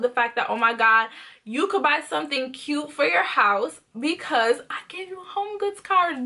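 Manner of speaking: usual speaking voice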